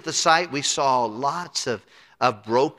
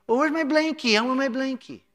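put-on toddler voice; 12 semitones higher than usual. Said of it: "they say dumb things".

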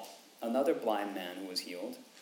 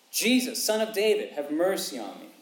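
high, loud call to Jesus; crying out; 7 semitones higher, 6 dB louder than usual. Acted. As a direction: cry out the name with all your strength. Beggar's cry retold.

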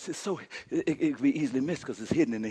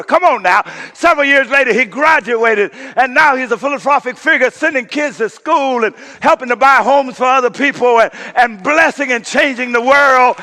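higher, loud, rolling sermon cadence; impassioned preacher in full flight; full-force preaching; 10 semitones higher, 12 dB louder than usual. Acted. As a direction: preach it to the rafters.

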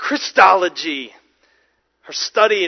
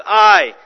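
"I." said alone, louder and set apart; stress on I; 6 dB louder than usual. Shown as a demonstration. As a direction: lean hard on the single word "I.". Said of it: "he's emphasizing".

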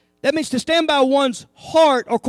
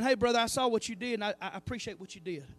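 soft, much quieter appreciation; sincere thanks; 6 semitones lower, 12 dB quieter than usual. Retold or imitated. A church member thanking a brother, soft and sincere. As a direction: thank him gently and sincerely. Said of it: "it's just about saying".

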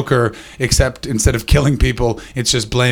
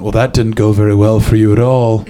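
low, loud posh English put-down; posh English accent; 4 semitones lower, 6 dB louder than usual. Retold or imitated. Posh flatmate to comic over fallen lilies, mock posh English accent. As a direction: deliver it as a posh English gent. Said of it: "posh English person".

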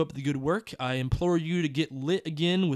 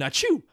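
high mock sneeze; fake sneeze; 9 semitones higher than usual. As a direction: read out a playful sneeze.

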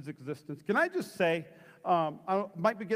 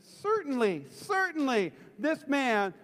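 higher, slow, emphatic accusation; insistent; 6 semitones higher, slower than usual. Acted. Another bystander accuses Peter an hour later, slow emphatic insistence. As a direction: insist it, drawing out each phrase. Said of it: "still another insisted".

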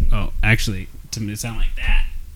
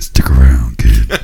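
Batman growl; 13 semitones lower, 4 dB louder, slower than usual. Pretend. Batman movie quote.

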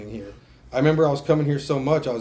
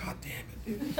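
mock groan; 9 semitones higher, 12 dB quieter than usual. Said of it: "I was like".

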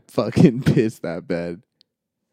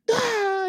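put-on high kid's wail. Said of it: "he starts pissing himself".

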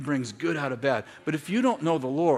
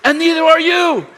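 much higher and louder, full-force shout; preaching shout; 16 dB louder than usual. Real, not acted. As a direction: belt it out to the standing crowd. Preacher shouts the line again.

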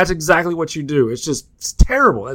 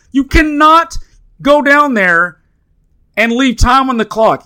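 higher and louder, driving each word home in disbelief; outraged shout; 9 semitones higher, 6 dB louder than usual. Real, not acted. Podcast host ranting to cohost about coaches, outraged shout. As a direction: yell it like the coaches committed a crime.

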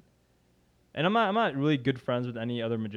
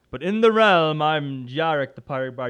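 grand, raised reveal of a fantasy persona; fantastical pirate character; 3 semitones higher, 7 dB louder than usual. Retold or imitated. Imitating a castmate's stage introduction, grand fantasy-character reveal. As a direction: launch loud and high into the realm, then settle. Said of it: "this completely fantastical character".

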